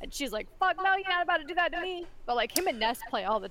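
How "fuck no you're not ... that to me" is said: high, put-on voice of an offended cat; indignant cat voice. Voicing the cat rebuffing Oliver, indignant. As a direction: play a cat refusing in a higher voice.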